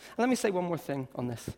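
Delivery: ordinary talk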